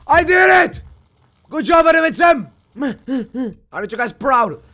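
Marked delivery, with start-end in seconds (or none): 0.1-2.5 high, loud victory cheer; triumphant shout. Real, not acted. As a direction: celebrate a hard-won success. Celebrating a win in game.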